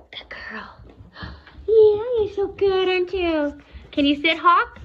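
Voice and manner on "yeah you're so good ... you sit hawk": loud, high, slowed praise; gushing praise. Real, not acted. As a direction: pour praise on the puppy. Praising the dogs.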